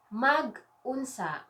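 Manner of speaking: drawn-out, slow teaching pronunciation; slow word model. Shown as a demonstration. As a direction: stretch the word out for learners.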